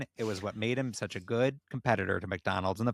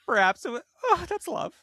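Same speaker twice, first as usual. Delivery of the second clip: reading through real laughter, much higher and louder; laughing while reading; 4 dB louder than usual. Real, not acted. Reading the fanfic to her cohosts, she laughs through the line. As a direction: try to get the line out while cracking up. Reader cracks up mid-reading.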